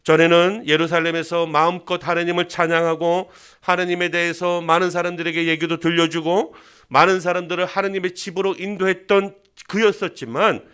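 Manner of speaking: normal talking voice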